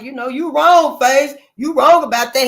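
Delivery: a loud mock scold from the crowd; mocking y'all. Mimicking folks who tell the speaker off, loud mock scold. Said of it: "And I know y'all like".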